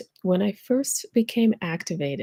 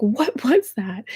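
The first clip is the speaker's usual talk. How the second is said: bewildered; 4 semitones higher, slower than usual. Retold or imitated.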